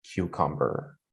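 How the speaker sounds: cucumber said low and soft with a falling tune; final list item falling low. Shown as a demonstration. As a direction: let cucumber drop down to close the list. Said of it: "the last option, my voice ... it's falling".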